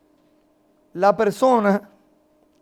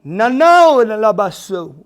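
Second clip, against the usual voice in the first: loud, ecstatic prayer outburst; speaking in tongues; 10 dB louder, much slower than usual. Real, not acted. Overcome while recalling the Lord's voice, real loud outburst in tongues. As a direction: cry out drawn-out syllables in fervor.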